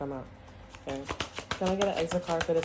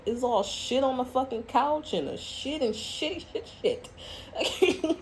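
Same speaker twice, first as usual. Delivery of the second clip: high, drawn-out dismay breaking into laughter; laughing mock disgust; 7 semitones higher, slower than usual. Acting out finding the soiled couch, laughing mock disgust. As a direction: act out grossed-out shock, cracking up.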